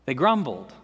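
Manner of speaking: normal talking voice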